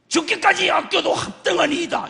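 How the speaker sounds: high, loud defiant outburst; shouted retort. Acted. Jonah shouting back at God about the gourd, defiant. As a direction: yell it back in hammed-up indignation. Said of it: "너 소리 질렀지?".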